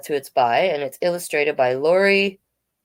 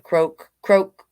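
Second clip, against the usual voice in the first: louder mock frog croak; 3 semitones higher, 5 dB louder than usual. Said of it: "I can't make that sound".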